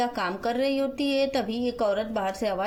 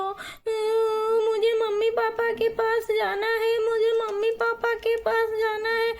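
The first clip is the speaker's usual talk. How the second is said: put-on crying little child; 11 semitones higher, 3 dB louder than usual. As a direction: whine for mummy and papa like a lost toddler. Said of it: "इतनी जोर से क्यों रो रही हो". (रोती हुई छोटी बच्ची की ऊँची आवाज़)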